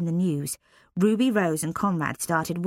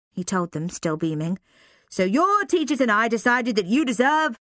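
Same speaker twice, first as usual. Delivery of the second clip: louder, beaming headmaster voice; headmaster announcing; 5 semitones higher, 4 dB louder than usual. Acted. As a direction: announce a treat to the school.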